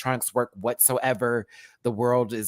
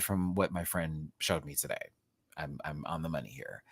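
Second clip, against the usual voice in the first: whisper; 8 semitones lower, 10 dB quieter than usual. Real, not acted. Aside whisper to viewers.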